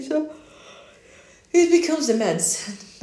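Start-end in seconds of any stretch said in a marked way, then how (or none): 1.5-3.0 words stretched through a yawn. Real, not acted. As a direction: talk mid-yawn, slow. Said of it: "I'm yawning because of the meds".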